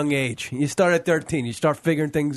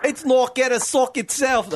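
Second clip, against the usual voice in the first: high mock-English punchline; English accent; 10 semitones higher than usual. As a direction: copy the joke in squeakier English. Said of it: "I'm using Virgin Zach's jokes now".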